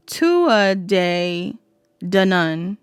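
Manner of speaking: Tuatha De Danann very slow, piece by piece; careful sounding-out. Real, not acted. Card reader tackling an Irish name, sounding out Tuatha De Danann. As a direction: sound out Tuatha De Danann with pauses between words. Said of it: "that's how it says".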